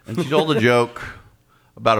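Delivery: ordinary talk, nothing marked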